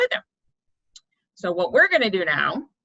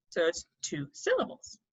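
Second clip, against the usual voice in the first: ordinary talk, nothing marked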